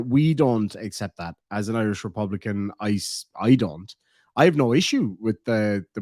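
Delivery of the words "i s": ordinary talk, nothing marked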